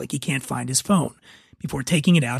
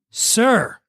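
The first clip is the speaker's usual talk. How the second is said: a raised, higher call of Sir; sharp call; 6 semitones higher than usual. Acted. Flight attendant calling a dozing passenger, sharp call. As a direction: snap Sir to get his attention. Attendant calls louder to Dan.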